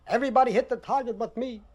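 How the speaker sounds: ordinary talk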